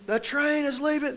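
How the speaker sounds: high-pitched imitation of a yelling coach; Randy Brewer screaming. Coach hounding a sick runner, screaming. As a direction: yell it like a drill instructor. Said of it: "Coach Brewer is screaming at me".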